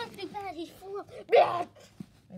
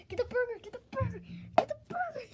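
whiny; 8 semitones higher, faster than usual. Acted. Whiny repeated demand.

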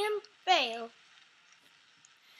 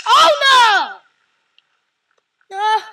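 loud, much higher cry of dismay; anguished shout; 4 semitones higher, 14 dB louder, much slower than usual. Real, not acted. Gamer watching his rider die, crying out loud. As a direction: wail as it all goes wrong. Character fails again.

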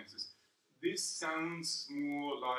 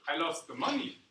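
end-stressed, "money" rising; 3 semitones higher, 9 dB louder than usual. Shown as a demonstration. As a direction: save the punch for the final word "money". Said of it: "It depends where you move emphasis".

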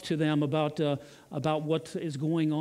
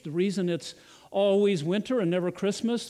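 normal talking voice

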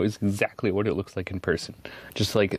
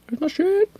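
"Where's my shit" much higher, squeaky rising; mock panic squeak; 3 dB louder than usual. Searching her desk, hammed-up panicky squeak. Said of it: "Ooh, where's my shit?".

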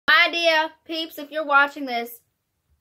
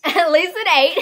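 laughing while repeating it; 5 semitones higher, 9 dB louder than usual. Real, not acted. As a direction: laugh through the words.

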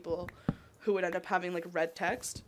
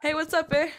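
higher, louder caricature of Canadian chat; mock conversational "eh"; 8 semitones higher, 7 dB louder than usual. Shown as a demonstration. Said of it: "we don't talk like that".

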